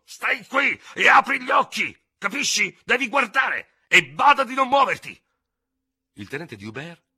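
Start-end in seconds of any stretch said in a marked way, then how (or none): 0.0-5.2 yelling in his ear. Acted. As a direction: bark orders right into his ear. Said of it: "urlandogli nell'orecchio". (urla minacciose nell'orecchio, acute e fortissime)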